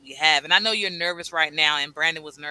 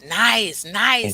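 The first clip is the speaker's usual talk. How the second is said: "Nice, nice" tossed out higher and louder in light mockery; mocking; 4 semitones higher, 6 dB louder than usual. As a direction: tease the comment, a bit higher and louder. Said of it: "I'm mocking on that one".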